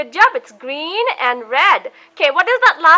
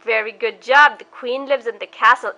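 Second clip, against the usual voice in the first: put-on reading to a toddler. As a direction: coax the little one through pictures. Talks a toddler through pictures.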